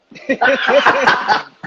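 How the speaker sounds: loud burst of real laughter; hearty laugh. Real, not acted. Amused by Eric's screen message.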